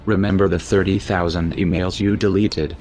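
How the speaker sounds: normal talking voice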